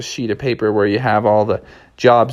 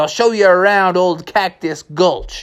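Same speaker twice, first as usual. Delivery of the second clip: high-pitched cartoon crook; high outlaw voice, proudly confessing; Criminal Kevin; 7 semitones higher than usual. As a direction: brag like a jailbird. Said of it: "Criminal Kevin".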